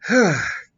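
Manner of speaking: big loud exhale of dismay; loud heavy sigh. Real, not acted. Host reacting to his dark idea, loud sigh.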